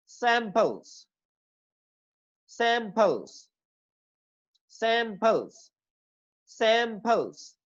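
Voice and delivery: Samples said slowly, "SAAM" drawn out and stressed; teacher's slow demo of samples, stress up front; long first syllable. Shown as a demonstration. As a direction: hold the first vowel of samples, drop on the second. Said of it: "इसको long करना है".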